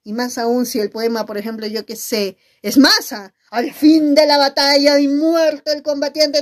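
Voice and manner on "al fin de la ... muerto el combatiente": louder declaiming voice; poem recital. Shown as a demonstration. Teacher showing how to recite a poem, louder declamation. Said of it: "a declamar un poema".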